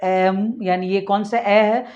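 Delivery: usual speaking voice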